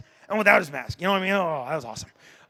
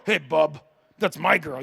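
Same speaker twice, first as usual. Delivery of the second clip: lower, growled Wolverine retort; 5 semitones lower than usual. Retold or imitated. Wolverine telling Cyclops the girl is his, low and tough.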